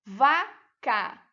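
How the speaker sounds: "Vaca" split into va-ca with a pause; syllable by syllable. Shown as a demonstration. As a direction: count out both syllables of "Vaca". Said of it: "duas sílabas".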